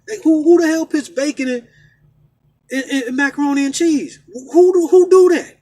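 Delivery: loud, high outraged rant; loud and high indignant outburst. Real, not acted. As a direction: shout your disgust at the cook. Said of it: "Let me get off that rant".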